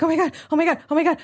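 mock out-of-breath muttered alarm; lightly panicked, winded repetition; panting panic. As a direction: act breathless from a frantic escape. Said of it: "I'm, like, panting, and I'm breathing".

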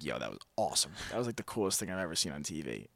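low, dazed stoned voice; dopey stoned marvel; stoned awe. Retold or imitated. Stoned viewer quote, low.

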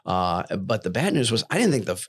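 ordinary talk

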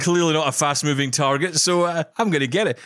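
high-pitched comic tiger sizing up prey; imagined tiger. Acted. Tiger picks the man over the gazelle, playful tiger voice. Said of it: "gigantic tiger saying".

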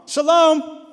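loud, high, mocking "Shalom!" across the yard; taunting shout. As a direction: sing it out to needle him. Taunting the hostile neighbour.

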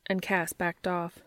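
ordinary delivery

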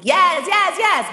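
high excited mock yes, yes, yes; mock eager. Imitating dudes drooling over big booties, high mock eager. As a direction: ham up an eager dude.

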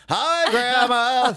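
high, slow, puppet-like hello; fake cheerful greeting. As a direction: wave the dead hand cheerily. Voices the corpse waving at grandma.

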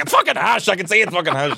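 exasperated guard. Retold or imitated. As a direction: call his bluff, fed up. Guard calls out spliff lie.

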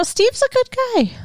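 high, sweet mock praise; mock sincere. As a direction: sing his praises mockingly.

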